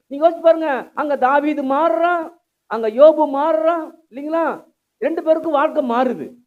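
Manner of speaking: high, loud exhortation; forceful preaching. Real, not acted. Preacher arguing from David and Job to the congregation, forceful. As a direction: press the congregation hard, voice lifted.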